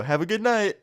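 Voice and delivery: high, taunting goodbye; mock-cheery farewell. Teller taunts his friend's fuming ex on leaving, mock-cheery.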